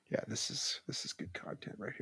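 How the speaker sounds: breathy whisper, quick. Real, not acted. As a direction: whisper it as an aside. Whispered aside.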